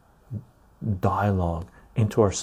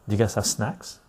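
ordinary talk, nothing marked

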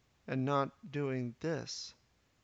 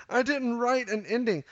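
laughing confession; 8 semitones higher, 6 dB louder than usual. Real, not acted. Laughing at himself, no ending.

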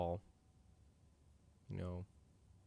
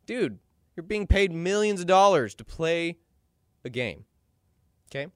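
exasperated scolding; 8 semitones higher, 6 dB louder than usual. Real, not acted. Scolding the player directly.

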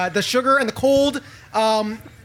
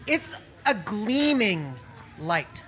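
hushed scene setting; 3 semitones lower, 4 dB quieter than usual. A narrator's reading. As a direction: build awe as it descends. Describes something descending from heaven.

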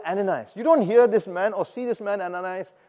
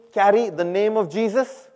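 raised, prompting call to a congregation; clear, slightly louder and higher prompt; call for repetition; 4 semitones higher, 6 dB louder than usual. Shown as a demonstration. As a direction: lead the crowd in saying it. Leads congregation in repeating.

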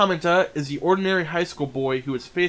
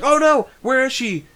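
higher-pitched, lightly panicked question; mock worried cry; 10 semitones higher, 4 dB louder than usual. Boy realizing she is gone, mock worry. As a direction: act out a worried little boy.

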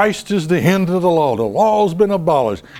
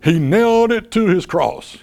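mock preacher. Mocking a preacher who thumps his Bible, lightly voiced preaching. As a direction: mimic a preacher proclaiming, half-serious. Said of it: "some preacher thumping on his Bible and saying".

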